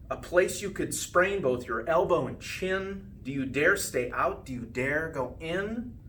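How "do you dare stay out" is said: plain reading voice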